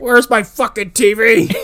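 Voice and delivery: high, loud, drawn-out demand; mock outrage. Co-host joining the bit about the missing TV, mock outrage. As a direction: bellow it slowly in comic indignation. Joins in as angry Charnetsky.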